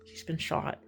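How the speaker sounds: whispered, unvoiced; hushed grim news. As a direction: drop to a whisper to deliver bad news. Neighbors telling husband grim news.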